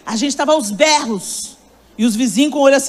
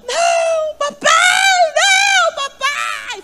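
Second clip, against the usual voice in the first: put-on high, wailing child's protest; much slower than usual. Granddaughter wailing from the bedroom during discipline, high wailing child. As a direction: play the toddler shrieking for daddy. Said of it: "Fazendo um drama no quarto".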